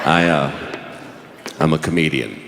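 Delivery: very low, halting mumble of an admission; sheepish confession. Retold or imitated. Juror admitting his job to the judge, sheepish and low. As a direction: sink deep and hesitate before owning up.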